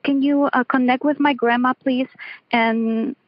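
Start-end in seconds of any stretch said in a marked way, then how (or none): none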